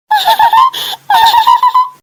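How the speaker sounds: high, squealing cackle; long mocking giggle fit. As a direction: burst out in high peals of laughter. Pupils laugh at the class leader.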